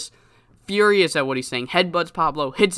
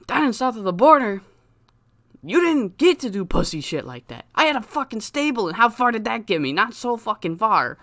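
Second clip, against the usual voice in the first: high, indignant rant; heated wrestler promo; 7 semitones higher than usual. Acted. Pablo answering Chris's accusation, heated and indignant. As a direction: throw it back at your rival, pitched up.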